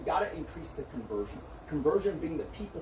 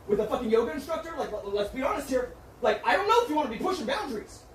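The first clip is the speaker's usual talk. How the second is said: loud, higher, faster jab; confronting partner, angry and raised; heated accusation; 6 semitones higher, 4 dB louder than usual. Real, not acted. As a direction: accuse her, voice rising.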